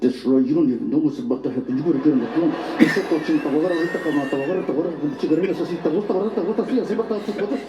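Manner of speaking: fast, mock-sultry dirty talk; late night voice. Lover talking dirty, exaggerated late night voice. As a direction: rattle off hammed-up bedroom talk, slipping into Spanish. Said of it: "late night voice".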